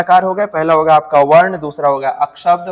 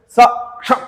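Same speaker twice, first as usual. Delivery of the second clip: सक्षम with a higher first syllable; stressed demo; 6 semitones higher, much slower than usual. Shown as a demonstration. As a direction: lean on the start of सक्षम. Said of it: "तो हम कैसे?".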